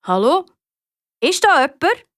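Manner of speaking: searching call, rising at the end. Acted. Patrick calling out towards the farm for anyone, calling. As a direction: call to see if anyone is there. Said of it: "Der Patrick ruft".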